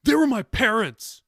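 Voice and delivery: loud, high, hurt shout; shouted retort. A narrator's reading. Grandson shouting at grandmother, loud and hurt. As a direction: shout it back at her.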